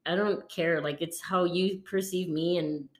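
usual speaking voice

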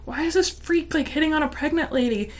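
incredulous thought. Retold or imitated. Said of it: "thinking in my head".

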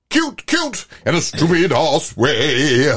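high squeaky start, then slow drawn-out words; camp, sneering delivery of the lyric; mock quoting. Host mocks the song lyric in a silly high voice.

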